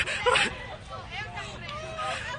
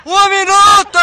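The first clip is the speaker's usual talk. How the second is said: shouting; 7 semitones higher, 9 dB louder, slower than usual. Real, not acted. Calling out time left.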